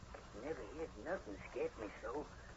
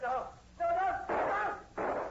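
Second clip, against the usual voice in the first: much higher, louder frantic no; panicked cry; 7 dB louder, much slower than usual. Acted. Andrew crying out in panic as the sergeant fires. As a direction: scream it in panic.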